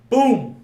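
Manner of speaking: slap sound. Retold or imitated. Retelling the Oscars slap to friends, loud mock impact. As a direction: punch out the sound of the hit.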